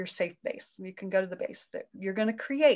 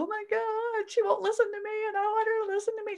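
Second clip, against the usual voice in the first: put-on much higher, distressed child-like inner voice, fast at the end; whiny inner voice; 13 semitones higher than usual. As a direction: whine like the hurt part inside. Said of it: "the voice inside that would go".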